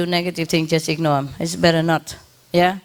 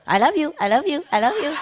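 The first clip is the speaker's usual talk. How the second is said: high, eager little bird voice; bird begging for peanuts; 7 semitones higher, faster than usual. Retold or imitated. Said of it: "he pick his head right away".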